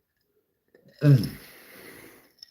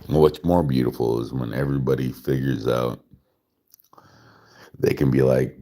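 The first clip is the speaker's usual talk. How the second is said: ordinary delivery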